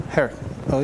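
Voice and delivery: normal talking voice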